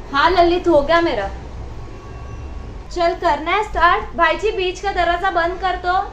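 shouting to Lalit over a weak connection; calling out. Real, not acted. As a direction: make yourself heard through the call.

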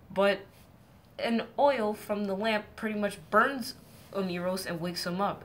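ordinary delivery

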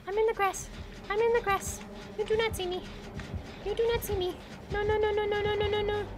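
tiny high-pitched chant, trying to stay unseen; high hiding squeak. Real, not acted. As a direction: squeak it like a nervous little incantation. Hiding in grass from killer.

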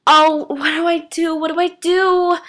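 high, fretful panic; panicking. A narrator's reading. Girl alone in her room, high panicked fretting. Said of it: "she began to panic".

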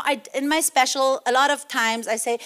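ordinary talk, nothing marked

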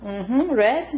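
sweet encouraging parent voice. Real, not acted. Parent coaching toddler while coloring.

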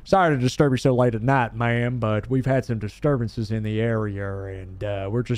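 police officer. Acted. Voices police officer in game.

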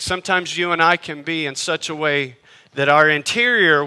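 ordinary talk, nothing marked

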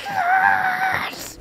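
very high shouted yes; high squealing celebration; elated cheer. Real, not acted. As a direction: scream with joy. Celebrating loot.

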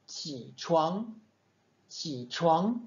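low "Qi" chained into a rising "chuang"; demi-troisième ton. Shown as a demonstration. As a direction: hold "Qi" low, then lift "chuang". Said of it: "resté en bas dans les graves". (« Qi » grave, puis « chuang » montant)

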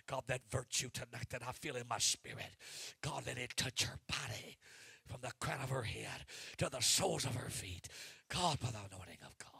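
loud, rising fervent prayer over someone; preaching prayer. Real, not acted. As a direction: pour it out like a revival preacher. Prays over woman at full force.

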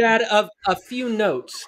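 put-on high-pitched kid giving feedback; put-on small-kid voice; five-year-old critic. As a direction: play a little child critiquing Dad, slowing at the end. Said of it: "critics are gonna be your five-year-olds".

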